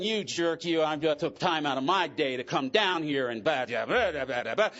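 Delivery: huffy muttered complaint to himself; resentful inner voice. Retold or imitated. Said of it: "I remember the feeling".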